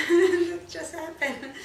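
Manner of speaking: amused, high, drawn-out laughing reply. Real, not acted. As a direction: laugh it off as you answer, slowly. Laughs while answering question.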